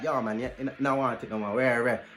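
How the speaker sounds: low and quiet mock Jamaican; deep mock voice. Friend voiced low and quiet, refusing the snapper. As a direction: drop the voice way down, mumbling.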